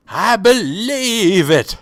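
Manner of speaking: high, slow, dopey mocking voice; mock believer voice. Mocking the witness's only evidence in a dopey voice. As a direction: parrot her claim like a simpleton.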